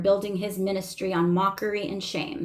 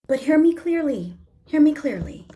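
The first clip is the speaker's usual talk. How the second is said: higher, louder stress on HEAR ME, falling on "clearly"; insistent emphasis; 7 semitones higher, 5 dB louder than usual. Real, not acted. Speaker demanding listeners' attention before the key point, insistent.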